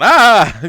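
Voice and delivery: loud high shriek; mock scream. Narrator acting out the boat tearing open, mock scream. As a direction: let out a sudden high-pitched yell.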